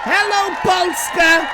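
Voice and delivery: very high, very loud, slow greeting; stadium shout. Real, not acted. Streamer hailing Polish viewers like a rock-star shout. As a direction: bellow it like a rock star to a crowd.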